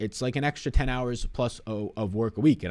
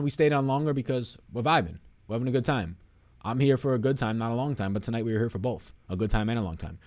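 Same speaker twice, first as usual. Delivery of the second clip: ordinary talk, nothing marked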